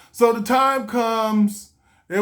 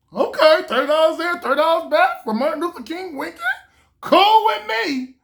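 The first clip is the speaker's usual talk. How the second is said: delighted; 9 semitones higher than usual. Retold or imitated. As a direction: sell the bargain to yourself. Cheap flight, delighted.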